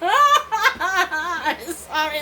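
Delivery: very slow, high sorry melting into laughter; laughing apology. Real, not acted. Woman recalling her fights, laughing through sorry. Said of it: "I'm laughing about it".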